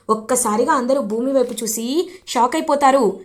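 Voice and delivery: ordinary delivery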